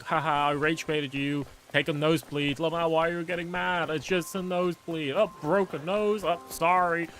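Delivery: hammed-up rage baiter. Acting out a smug rage baiter who gets punched, loud and taunting. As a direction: ham up a smug troll getting punched. Acting a mock fight scene.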